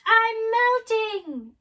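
very high, loud, slow wail; witch's shriek. Acted. Witch melting under the water, shrieking. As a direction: stretch out a dying shriek. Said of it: "who began to shriek".

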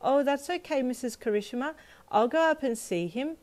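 put-on high, chirpy little girl; bright. A narrator's reading. Girl offering his mum to go up, brightly. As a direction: offer cheerfully in a child's high voice. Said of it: "you told her brightly".

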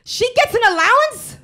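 high, indignant mock wail; upset outburst. Imitating daughter jealous of friend's allowance, upset shriek. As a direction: cry out in loud disbelief, way up high. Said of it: "She's, like, really upset".

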